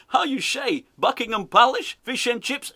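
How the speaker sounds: put-on high, slushy-S Dutch accent; mock Dutch accent. Host parodying manager asking for words, mock Dutch accent. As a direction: ham up the fake Dutch question. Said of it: "He's speaking in a Dutch accent".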